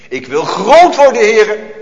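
put-on a rebellious child crying out; defiant cry. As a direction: demand it up at heaven. Voicing the rebellious flesh.

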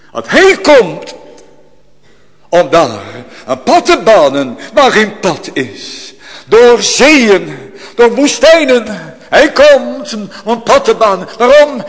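high, loud, rising praise; exultant proclamation. Acted. Preacher proclaiming to the church, loud exultant praise. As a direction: proclaim it with growing joy.

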